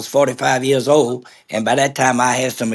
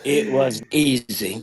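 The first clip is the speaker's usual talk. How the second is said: slow emphasis; 3 semitones lower, 5 dB quieter, slower than usual. Real, not acted. Repeats his punchline after the laugh.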